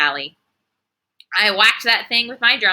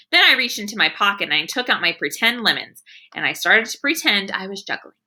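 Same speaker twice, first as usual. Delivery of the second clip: usual speaking voice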